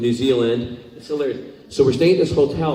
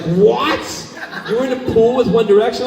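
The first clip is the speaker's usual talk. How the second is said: excited disbelief; 10 semitones higher than usual. Retold or imitated. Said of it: "she's like".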